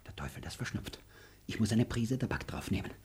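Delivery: stung whisper to himself; muttered aside. Acted. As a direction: grumble it to himself. Aside after her snub.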